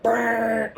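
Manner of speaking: high loud lip-buzz fart; storyteller mimicking the fart; imitated fart noise. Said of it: "I can't even mimic it".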